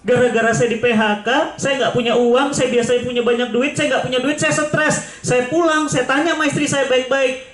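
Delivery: a hint of a desperate man explaining; voicing the prisoner. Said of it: "retold prisoner's confession".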